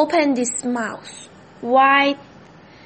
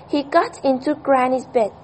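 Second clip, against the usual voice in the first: plain reading voice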